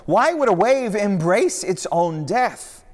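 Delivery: high, loud, pleading disciple; frantic Peter. Acted. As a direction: Peter begging his master not to give up. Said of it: "Peter cried".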